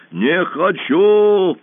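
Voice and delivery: loud, otherworldly roar; roaring monster. A narrator's reading. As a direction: roar the refusal. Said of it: "проревело чудовище".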